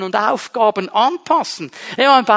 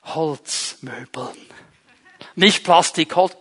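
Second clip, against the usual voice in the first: the Lord answering; 3 semitones lower than usual. Acted. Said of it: "vielleicht sagt der Herr".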